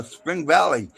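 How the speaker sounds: ordinary delivery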